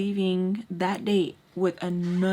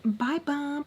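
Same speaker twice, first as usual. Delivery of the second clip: high, playful goodbye; playfully teasing; 7 semitones higher than usual. Retold or imitated. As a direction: toss out a teasing farewell, pitched up. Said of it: "trying to be funny and stuff".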